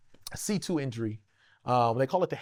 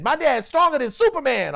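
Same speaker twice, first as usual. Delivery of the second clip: son bragging; 10 semitones higher, 7 dB louder than usual. Retold or imitated. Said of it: "And he said".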